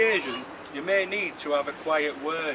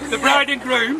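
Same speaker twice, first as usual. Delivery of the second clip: toasting; 7 semitones higher, 5 dB louder than usual. Real, not acted. Leads the toast.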